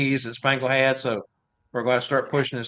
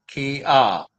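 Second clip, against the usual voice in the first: ordinary talk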